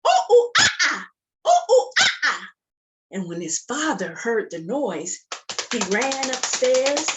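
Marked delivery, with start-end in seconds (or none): 0.1-2.5 very high, loud laughing monkey calls; monkey hoots. Acted. Jabari and the monkey playing, high hoots. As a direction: hoot like a giddy monkey. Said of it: "then they went".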